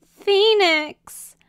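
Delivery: high, loud wail; tearful cry. Acted. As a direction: cry out through streaming tears. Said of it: "Quixotic cried".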